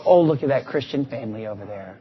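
hushed, impressed aside; mock gossip. Churchgoers admiring a family at prayer, hushed. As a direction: murmur it like admiring onlookers. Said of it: "People go".

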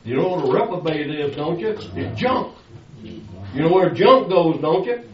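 ordinary delivery

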